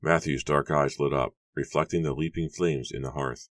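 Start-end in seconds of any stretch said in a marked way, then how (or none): none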